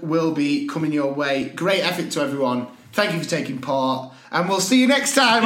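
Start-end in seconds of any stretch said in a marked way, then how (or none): none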